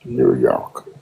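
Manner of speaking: a fake accent, held steady; trolling the room in a put-on voice. Caller trolling the room about Charlie Kirk, put-on accent. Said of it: "not with that accent".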